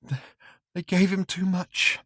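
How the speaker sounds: high, small frightened whimper; thin pleading whimper; tiny whimper. Acted. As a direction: whimper it out like a broken man. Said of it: "he said in a tiny whimper".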